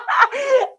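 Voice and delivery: a sudden high shriek of a laugh. Real, not acted. Laughing at friends buying jacuzzis and islands, big shrieking laugh. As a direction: let a big laugh burst out.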